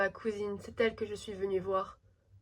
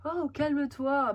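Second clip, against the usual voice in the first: usual speaking voice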